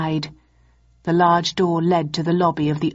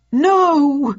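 loud, high-pitched cry of protest; sudden high, loud outcry; alarmed cry; 9 semitones higher, 6 dB louder than usual. A narrator's reading. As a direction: cry out to stop them. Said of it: "cried Mycroft".

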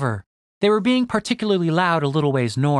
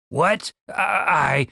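flustered, halting stammer; much slower than usual. A narrator's reading. Dave thrown by Stacy's teasing warning, stammering. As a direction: stumble over the first word, caught off guard. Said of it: "Dave stammered".

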